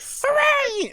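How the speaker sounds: very high, loud squeal; Toad cheer. Retold or imitated. Friend voicing Captain Toad's cheer, very high and loud. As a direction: cheer it like a tiny mushroom. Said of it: "He's like".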